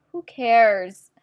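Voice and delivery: loud dismissive. Real, not acted. Shrugging off her own explanation.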